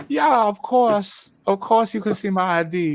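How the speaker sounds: high, drawn-out mock Vietnamese accent; slow, hammed-up Vietnamese-accented English. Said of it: "sounds like stereotypical Vietnamese".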